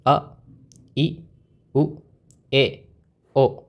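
each vowel of अ, ई, उ, ए, ओ said singly, with a pause after it; pronunciation demo. Shown as a demonstration. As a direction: model अ, ई, उ, ए, ओ slowly for a class, vowel by vowel. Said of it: "फिर से एक बार pronounce करते हैं". (अ, ई, उ, ए, ओ एक-एक स्वर ठहर कर बोलना)

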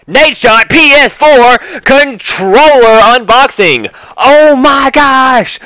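high, loud, drawn-out hype shout; hyped-up announcement. Acted. Vlogger revealing a controller unboxing, over-the-top hype. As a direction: shout the unboxing like it's huge news.

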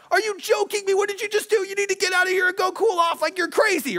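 high-pitched, incredulous outburst; retold angry rant at his wife; freaking out. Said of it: "I'm freaking out".

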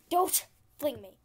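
loud protest after a laugh. Real, not acted. Player laughing at being flung, loud protest. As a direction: protest loudly, half amused.

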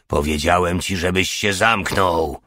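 plain reading voice

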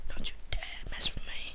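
low hard-boiled whisper; whispered tough-guy threat; noir detective. Pretend. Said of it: "Elwin Noir style".

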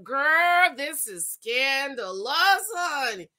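ordinary delivery

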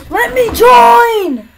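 high, loud, drawn-out yell; exasperated shout at the game; frustrated yell. Real, not acted. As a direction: cry out and stretch the last word. Frustrated at failing to join game.

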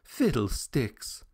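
mildly mocking, no real laugh; amused dismissal. A narrator's reading. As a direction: brush it off lightly. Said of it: "she laughed".

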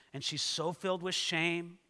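ordinary talk, nothing marked

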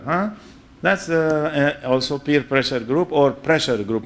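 ordinary delivery

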